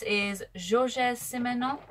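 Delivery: Simenon said slowly in a French accent; French accent on Georges Simenon. Shown as a demonstration. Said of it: "That is the best French accent I can do".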